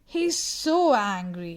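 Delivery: usual speaking voice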